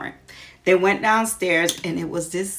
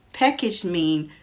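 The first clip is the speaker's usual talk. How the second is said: ordinary delivery, nothing marked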